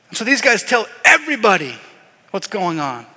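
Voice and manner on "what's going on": ordinary talk, nothing marked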